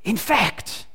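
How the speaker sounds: conspiratorial hush; dropped to a whisper. Acted. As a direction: drop it low like a secret. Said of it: "she drops her voice to a whisper".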